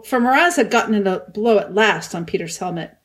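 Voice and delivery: ordinary delivery, nothing marked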